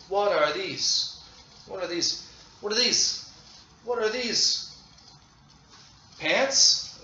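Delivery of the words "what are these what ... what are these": choral question. Shown as a demonstration. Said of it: "Everyone together. Question".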